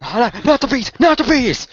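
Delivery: high, loud fake terror; hammed-up Nicolas Cage bee panic; mock panic. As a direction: shriek like the bees are coming. Mock panic over the bees.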